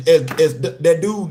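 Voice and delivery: normal talking voice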